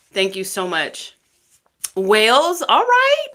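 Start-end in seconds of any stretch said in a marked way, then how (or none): 1.9-3.3 high, rising Welsh lilt; loud, playful Welsh singsong; singsong Welsh accent. Acted. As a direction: put on a Welsh accent that climbs up at the end. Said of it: "speak, like, as if they're singing".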